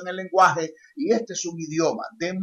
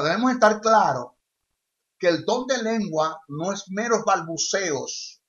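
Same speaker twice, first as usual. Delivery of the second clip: normal talking voice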